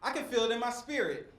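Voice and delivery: usual speaking voice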